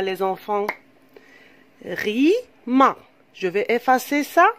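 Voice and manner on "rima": "Rima" broken as Ri... ma; split into syllables. Shown as a demonstration. Teacher dividing the word for children, "Rima" said syllable by syllable. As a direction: stretch "Rima" into two beats with a gap between. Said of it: "on va diviser le mot en syllabes".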